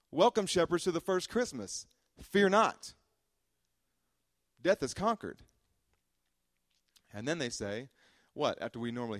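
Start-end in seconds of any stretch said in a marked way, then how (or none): 0.1-5.4 higher, louder triumphant proclamation; preaching climax. Acted. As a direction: announce it like the angels.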